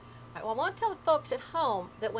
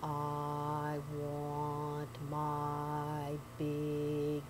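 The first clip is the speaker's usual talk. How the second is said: low, very slow moaning ghost; 7 semitones lower, 7 dB quieter, much slower than usual. Acted. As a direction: drag every word out, low and hollow. Ghost voice in haint tale.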